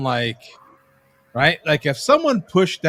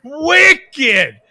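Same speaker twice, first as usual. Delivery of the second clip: put-on Boston accent; 9 semitones higher, 7 dB louder than usual. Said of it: "I got the freaking Boston accent down".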